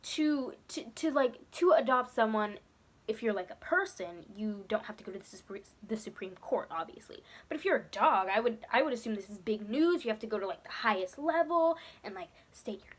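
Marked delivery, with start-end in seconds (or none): 4.4-7.2 trailing whispered aside. Real, not acted. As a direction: drop to a whisper as an afterthought.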